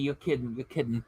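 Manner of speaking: soft, low you're kidding; quiet, stunned mutter; muttered disbelief. Real, not acted. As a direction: say it half to yourself, stunned. Disbelief at a viewer's comment.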